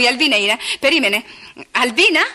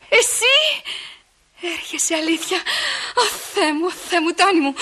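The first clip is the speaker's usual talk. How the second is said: high, gasped amazement; barely believe the good news; overjoyed disbelief; 7 semitones higher, slower than usual. Acted. Μαθαίνει με χαρά ότι έρχεται. (έκπληκτη, συνεπαρμένη από χαρά)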